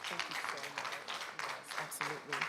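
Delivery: ordinary delivery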